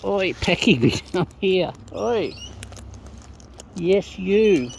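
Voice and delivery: loud, high teasing call; calling out to a bird. Real, not acted. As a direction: call the bird over, half scolding.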